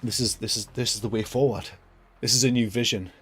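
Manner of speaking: lightly voiced pompous brand boss; mock earnest executive. Mocking Jaguar bosses, a touch of soft smugness. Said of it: "they were just sat there like".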